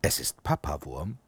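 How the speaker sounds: ordinary delivery, nothing marked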